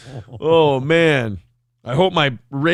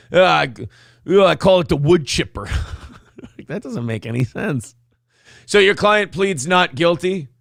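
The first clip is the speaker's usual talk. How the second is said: ordinary delivery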